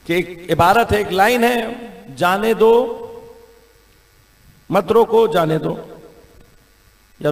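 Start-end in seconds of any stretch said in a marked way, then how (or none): 2.2-5.9 "جانے دو", "مت روکو" and "جانے دو" set apart by pauses; pause placement demo. Shown as a demonstration. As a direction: read it out in separate phrases, pausing after "جانے دو". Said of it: "آپ نے کہاں کوما لگانا ہے". (وقفوں کے ساتھ الگ الگ جملے)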